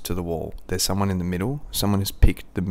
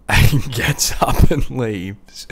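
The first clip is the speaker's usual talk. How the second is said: real laughter, loud and very slow; words squeezed out through laughter; laughing; 5 semitones higher, 11 dB louder, much slower than usual. Real, not acted. Finishing the toilet story, laughing loud and slowly. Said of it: "So I laughed".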